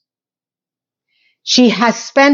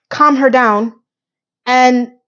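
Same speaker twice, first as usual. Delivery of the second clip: ordinary talk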